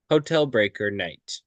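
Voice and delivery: usual speaking voice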